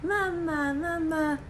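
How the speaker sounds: put-on high, cooing baby voice calling mama; imitating baby son. Son on FaceTime calling out to his mom, high baby voice. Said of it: "he was like".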